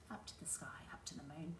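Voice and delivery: hushed whisper. Acted. Teacher whispers to the children after the jump, hushed. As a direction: whisper it like a secret.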